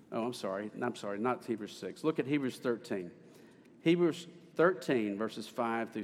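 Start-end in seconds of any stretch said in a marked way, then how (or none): none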